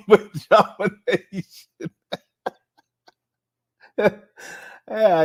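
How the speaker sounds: slow, laughing out pajama nation. Real, not acted. Streamer cracking up at his own pajama nation joke. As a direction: squeeze the words out mid-laugh.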